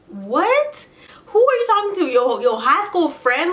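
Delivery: high-pitched, indignant comeback; mock incredulous. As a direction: fire back as if you can't believe him. Acted incredulous comeback to Kirk.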